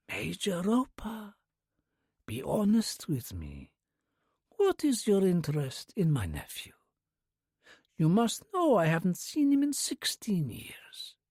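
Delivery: put-on whispered, slow Russian-accented old lady; soft Russian accent. A narrator's reading. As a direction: breathe it like a breeze, Russian accent. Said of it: "the Russian accent undeniable".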